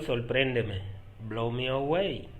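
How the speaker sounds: ordinary talk, nothing marked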